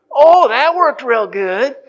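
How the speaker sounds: slow, loud dry sarcasm; sarcastic. Retold or imitated. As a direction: drag it out mockingly. Kids mock father's failed plan.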